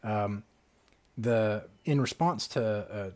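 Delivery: ordinary talk, nothing marked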